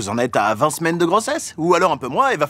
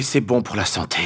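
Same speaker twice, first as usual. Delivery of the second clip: ordinary talk, nothing marked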